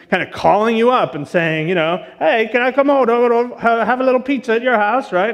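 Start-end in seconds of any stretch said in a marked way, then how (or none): 2.2-5.1 high, lively mock-British phone voice; thick British accent. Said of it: "a thick British accent".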